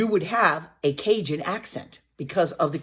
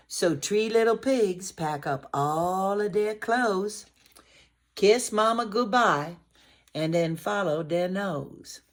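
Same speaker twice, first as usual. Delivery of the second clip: storybook reading; 5 semitones higher than usual. Acted. Teacher reading a Cajun rhyme aloud to children. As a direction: read the rhyme with bouncing energy.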